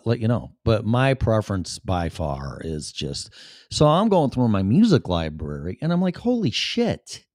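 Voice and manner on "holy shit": amazed. Retold or imitated. Quoting his own amazed reaction.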